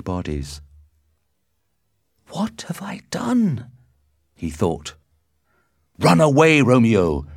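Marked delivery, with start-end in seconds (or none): 2.3-3.7 slow, whispered shock; horrified whisper. A narrator's reading. Romeo stands over the two bodies, whispering in horror. 6.0-7.3 loud, urgent warning shout; crying out. A narrator's reading. Benvolio warns Romeo to flee, crying out. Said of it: "cried Benvolio".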